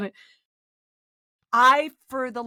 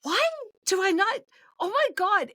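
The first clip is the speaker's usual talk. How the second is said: much higher, mock disbelief at herself; exasperated disbelief; 10 semitones higher than usual. Stuck on a word while recording, mock exasperated squeak. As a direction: throw it up into a high squeak of frustration. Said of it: "I was like".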